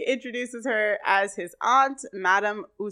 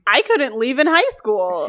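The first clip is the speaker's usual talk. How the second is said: incredulous; 4 semitones higher, 7 dB louder than usual. Real, not acted. Incredulous reply about leaving school.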